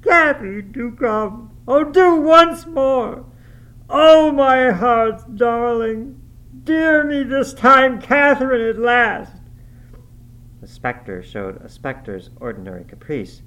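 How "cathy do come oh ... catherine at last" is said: high, loud, drawn-out wail of anguish; slow, desperate cry at full voice; sobbed plea. A narrator's reading. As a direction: beg the lost beloved in uncontrollable grief. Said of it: "he sobbed".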